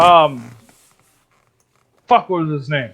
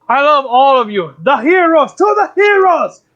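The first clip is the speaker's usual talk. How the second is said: high, climbing celebratory cry; exuberant bear toast; 8 semitones higher, 4 dB louder than usual. Acted. Bear toasts the heroes in game.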